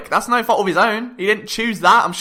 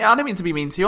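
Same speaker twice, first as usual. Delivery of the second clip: ordinary delivery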